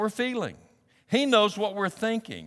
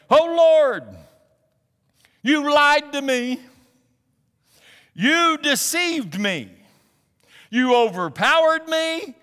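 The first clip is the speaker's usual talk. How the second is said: high, loud, drawn-out accusing lament; fussing at God; 7 semitones higher, 6 dB louder, slower than usual. Retold or imitated. Prophet Jeremiah accusing God in prayer, loud and aggrieved. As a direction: hurl each accusation upward with long pauses between. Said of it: "okay to fuss at God".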